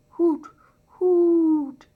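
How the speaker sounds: high, loud hoot of an owl. Acted. Owl calling out in the dark night, high hoots. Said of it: "an owl called out".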